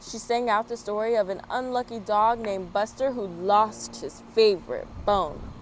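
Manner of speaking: plain reading voice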